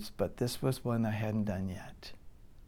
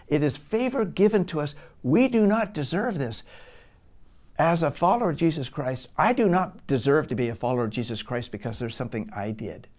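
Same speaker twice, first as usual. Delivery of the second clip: louder, higher, pressing each point home; preaching at full force; 3 semitones higher, 5 dB louder, faster than usual. Acted. Preacher driving home grace to the church, forceful. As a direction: preach it hard to the congregation.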